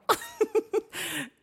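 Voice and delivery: high burst of laughter; embarrassed laugh. Real, not acted. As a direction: laugh at your own slip. Laughs at own flubbed intro.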